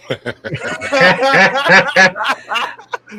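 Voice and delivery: long, high, loud burst of laughter. Real, not acted. Reacts to own booing remark.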